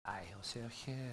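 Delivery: very low, soft mumble to oneself; low, trailing murmur while searching; hushed. Retold or imitated. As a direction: drop right down, almost under the breath. Mother searching, finds nothing.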